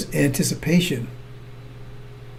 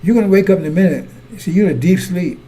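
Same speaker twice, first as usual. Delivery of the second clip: loud warning; 3 semitones higher, 5 dB louder than usual. Real, not acted. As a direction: fire the warning out loud and quick. Preacher's loud warning, then repeated.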